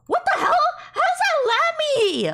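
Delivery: normal talking voice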